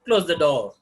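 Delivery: "Close the door" as a loud order, falling; command. Shown as a demonstration. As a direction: punch CLOSE, then let "the door" drop. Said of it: "suppose we can say that".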